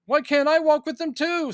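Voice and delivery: put-on high, eager child pleading to join in; kid voice. A kid watching Superman, high and eager to join. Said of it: "if I had been a kid".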